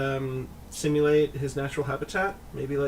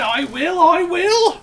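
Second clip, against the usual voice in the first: higher, louder maid voice; scullery maid voice; 13 semitones higher, 9 dB louder, slower than usual. Pretend. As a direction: squeak it like an eager scullery maid. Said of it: "Talk like a scullery maid".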